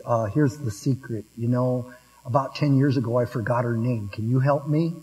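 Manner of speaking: leise, verschwörerisch geflüstert; whispered secret. Acted. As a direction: lean in and murmur it like a confession. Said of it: "leans over to the pastor and whispers".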